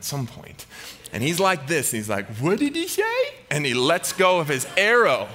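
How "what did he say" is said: very high, goofy confused voice; clueless guy. Acted. The one soldier who missed the order, high clueless voice. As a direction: play the hapless coworker who missed it.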